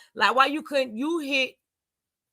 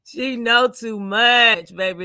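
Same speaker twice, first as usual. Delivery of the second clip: slow tease; 4 dB louder, slower than usual. Real, not acted. Drawn-out teasing line.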